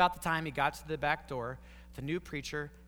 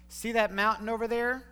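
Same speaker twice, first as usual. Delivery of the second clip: put-on higher and louder, an eager old man pointing; lively old storyteller; 7 semitones higher, 6 dB louder than usual. Old gentleman eagerly begins his story for the couple, loud and bright. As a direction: call their eyes to the mountain.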